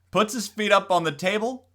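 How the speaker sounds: ordinary delivery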